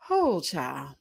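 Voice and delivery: normal talking voice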